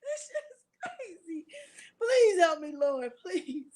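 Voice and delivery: begging through tears of laughter; laughing to tears. Real, not acted. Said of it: "I really got tears coming out my eyes".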